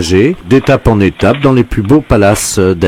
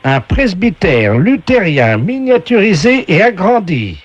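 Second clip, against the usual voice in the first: high, slow host announcing prizes; game show voice; 7 semitones higher than usual. Acted. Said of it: "la voix du jeu".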